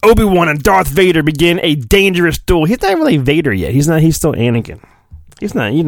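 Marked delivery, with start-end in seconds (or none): none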